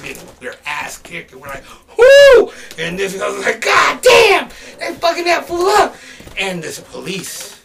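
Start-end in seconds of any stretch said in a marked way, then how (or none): 2.0-2.5 piercingly high, loud whoop. Retold or imitated. Friends watching people get beaten, loud whoop. Reacting to brawl, retold. 3.6-5.9 high, very loud exclamation; retold amazed shout. Rapper watching someone get beaten, amazed shout.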